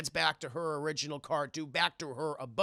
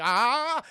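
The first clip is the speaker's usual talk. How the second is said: startled yelp; 7 semitones higher, 8 dB louder than usual. Acted. Tulip yelps as attacked.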